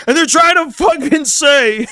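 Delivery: high mock raging outburst; Lucas Gage raging. Podcaster mimicking a ranting guest, high mock rage. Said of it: "when he was raging about it".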